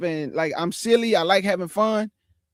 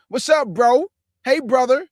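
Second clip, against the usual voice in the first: louder, higher mock "bro" greeting; chummy put-on greeting, pitched up; 6 semitones higher, 5 dB louder than usual. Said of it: "Stop with the say, bro".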